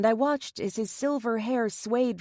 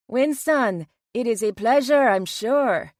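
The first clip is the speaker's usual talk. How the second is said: louder, hammed-up British slipping into Jamaican; failed British accent; 2 semitones higher, 6 dB louder than usual. A narrator's reading. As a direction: attempt posh British, land in Jamaican. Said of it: "I attempted to say in my best British accent, but it came out Jamaican".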